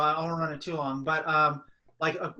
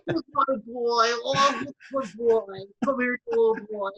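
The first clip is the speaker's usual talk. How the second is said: high, louder cooing, drawn out slowly; pet baby talk; 8 semitones higher, 5 dB louder than usual. Retold or imitated. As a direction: croon to the cat like a baby. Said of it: "the crazy person voice with your animals".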